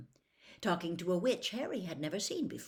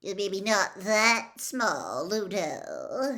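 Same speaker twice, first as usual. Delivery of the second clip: slow, louder knowing purr; sly journalist; 3 semitones higher, 8 dB louder, much slower than usual. Acted. Gossip reporter teasing the judge, slow and sly. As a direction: tease him slyly. Rita Skeeter voiced.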